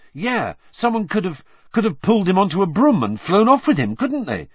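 rushed, high and fast; quick. A narrator's reading. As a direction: blurt it out in a hurry. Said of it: "said Ron quickly".

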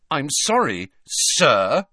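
"sir," set apart with pointed weight; stress on sir. A narrator's reading. Harry apologising to Hagrid, pointed stress on "sir,". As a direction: lean hard on "sir,". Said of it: "emphasizing the last word".

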